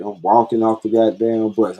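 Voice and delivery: ordinary delivery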